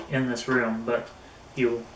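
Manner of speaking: ordinary talk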